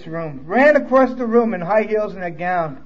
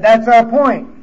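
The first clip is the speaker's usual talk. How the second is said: loud retort; 4 semitones higher, 8 dB louder, slower than usual. Retold or imitated.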